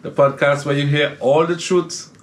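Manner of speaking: high, slow announcer welcome; podcast intro. Acted. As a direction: roll out the show intro like a radio host. Opens podcast as host.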